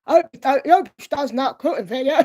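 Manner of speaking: high, loud hammed-up Cockney; exaggerated Cockney impression of him; extra Cockney accent. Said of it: "give him an extra Cockney accent".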